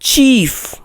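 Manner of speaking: "चीफ" said as cheef with a clear ch sound; teacher's model word "चीफ" with ch; correct pronunciation. Shown as a demonstration. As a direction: model "चीफ" cleanly, ch as in chair. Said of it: "इसका correct pronunciation है".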